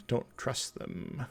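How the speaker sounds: slow, breathy conspiratorial whisper; suspicious whisper. Real, not acted. Streamer warning viewers about France, in a slow whisper. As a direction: hiss it like a secret to the chat.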